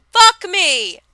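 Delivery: loud, high, thrilled cry; excited shout. Acted. Vlogger shouting her favorite phrase, loud and high. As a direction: blurt it out in delight.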